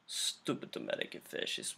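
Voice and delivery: low, very soft mumbled villain voice; mock Russian villains. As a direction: grumble it under your breath as a caricature Russian captor. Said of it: "the Russians were all just".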